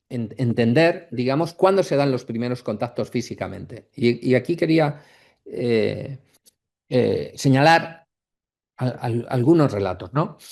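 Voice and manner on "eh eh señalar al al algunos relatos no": ordinary talk, nothing marked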